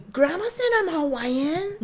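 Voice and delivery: put-on high, pleading child voice; whiny kid protest. Little girl protesting to brother, high whiny voice. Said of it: "sister protesting to brother".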